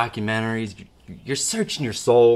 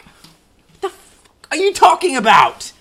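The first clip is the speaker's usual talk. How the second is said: exasperated outburst; 13 semitones higher, 7 dB louder than usual. Acted. Skit, Harry snaps back.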